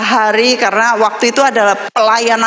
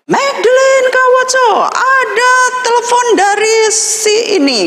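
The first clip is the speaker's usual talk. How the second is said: calling out. Retold or imitated. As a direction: shout her name across the dormitory. Dorm staff calling her to phone.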